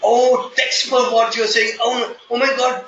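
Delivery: startled surprise. Real, not acted. Trainer realizes they want captions.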